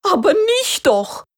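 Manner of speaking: high, loud, flustered rooster cry; agitated rooster. A narrator's reading. Rooster to the donkey, high agitated protest. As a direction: squawk it out in a panic. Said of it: "antwortete der Hahn ganz aufgeregt".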